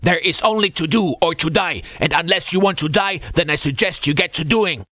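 high, gruff scolding; stern rebuke to a junior sailor; veteran scolding. A narrator's reading. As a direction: scold him like a veteran navy chief. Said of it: "the veteran scolded his junior sailor".

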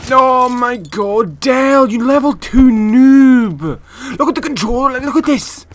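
loud, high-pitched yelling; real frustrated rage. Real, not acted. Gamer raging at controller and noob, furious shouting. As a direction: shout in outrage at a failing controller. Rages at controller mid-game.

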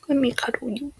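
soft unvoiced cuddly whisper; tender whisper. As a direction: whisper it while holding him.

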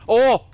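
high loud jump of fright; sudden scared cry; startled yelp. Real, not acted. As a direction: blurt it out scared. Scared by game noise.

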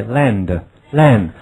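slow drilled repetition; slow model reading. Shown as a demonstration. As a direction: say it twice slowly for students to copy. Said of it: "荣耀飞".

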